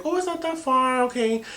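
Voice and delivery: high-pitched breezy reassurance to oneself. Retold or imitated. Woman retelling her first glance at the map, breezy. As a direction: lift it high and casual.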